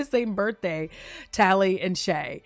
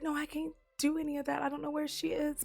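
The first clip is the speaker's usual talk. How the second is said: higher and much softer refusal; a small, hushed high no; lightly voiced girl quietly protecting her friend; Tally refusing; 8 semitones higher, 9 dB quieter, faster than usual. Tally refusing President Pretty.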